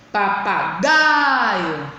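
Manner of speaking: "papagaio" slowly, GAI belted out and stretched; forceful stressed syllable. Shown as a demonstration. Teacher showing pupils the strong syllable, forceful GAI in "papagaio". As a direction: lean hard and long on GAI in "papagaio". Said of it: "eu posso dizer que ela é com mais força".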